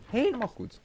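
high, soft voice; high-pitched approval. Real, not acted. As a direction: lift the pitch right up and ease off the volume. Repeats approval higher and softer.

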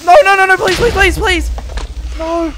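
high, loud frantic begging; panicked cries; desperate pleading. Real, not acted. Dying in game.

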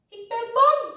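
high, loud scared complaint; high-pitched fearful whine; frightened wail. A narrator's reading. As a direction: wail it like a scared little mouse. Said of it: "klaagde Suzy".

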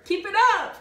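high, loud shout of encouragement; cheering yell. Retold or imitated. Crew yelling to skipper mid-race, loud cheering shout. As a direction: call it out across the boat to cheer her on. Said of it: "she would yell".

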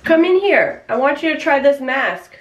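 loud, high, coaxing call. Acted. Prankster inviting partner to try mask, calling out. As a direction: summon your partner from the next room.

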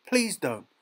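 high, loud falling plea; desperate plea. A narrator's reading. Boy pleads for the swan before the shot, crying out. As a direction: beg him urgently to stop. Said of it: "screamed Peter".

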